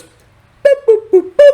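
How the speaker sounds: very high, loud hooted boops; piercing mouth-made flute notes; imitated flute toots. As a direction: toot like a badly played flute. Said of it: "I play my flute like this".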